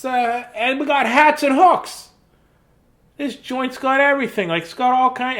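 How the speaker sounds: cheerful storybook mouse showing off the house. Acted. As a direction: chirp it out like a little mouse. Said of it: "this is the mouse voice there".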